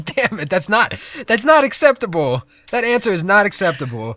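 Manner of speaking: high, loud mock indignation; mock outrage. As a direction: protest loudly, half laughing.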